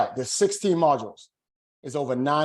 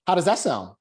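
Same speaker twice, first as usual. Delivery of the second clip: "How does that sound?" lifted higher, unsure; uncertain upswing; 4 semitones higher, 4 dB louder than usual. Shown as a demonstration. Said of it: "When you upswing, that communicates uncertainty".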